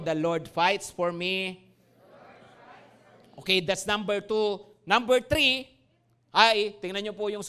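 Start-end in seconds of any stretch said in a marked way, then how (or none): 0.0-1.6 slow, steady first-person declaration; slow response line. Shown as a demonstration. Congregation told to repeat, slow declaration. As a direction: answer back "The Lord fights for me" slowly, stressing "fights". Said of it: "Okay, sabihin mo".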